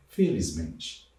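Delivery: felizmente said slowly and softly, each syllable separate; slow teacher demo; slow, drawn out. Shown as a demonstration. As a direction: model felizmente at half pace. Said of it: "acrescentar o sufixo mente".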